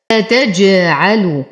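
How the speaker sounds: slow أتجعل with echoed jim; bouncing jim. Shown as a demonstration. Teacher reciting for students, slow أتجعل with qalqala. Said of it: "نقلقل للجيم".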